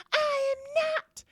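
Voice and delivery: mother imitating her daughter's crying denial; mock crying daughter. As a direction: wail it like a teenager in tears. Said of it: "And she was like".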